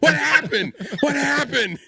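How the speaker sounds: high, laughing panic; baffled. Retold or imitated. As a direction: repeat it in mock alarm. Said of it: "I'm like".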